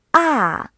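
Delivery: 啊 starting high and falling; fourth tone. Shown as a demonstration. Teacher demonstrating the fourth tone on 啊, a fall from high. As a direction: let 啊 drop from high. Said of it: "四声".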